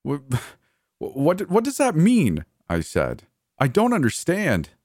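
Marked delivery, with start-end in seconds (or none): 0.1-2.4 much higher, panicky stammering question; breaking out in bewildered panic; alarmed stammer. A narrator's reading. Horrified narrator demands meaning. 3.6-4.7 high-pitched, upset bewilderment; much higher, thrown; distressed confusion. A narrator's reading. Frightened confusion in same exchange.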